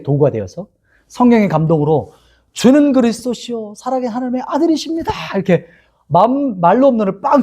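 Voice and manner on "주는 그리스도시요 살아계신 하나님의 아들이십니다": Peter's confession. Retold or imitated.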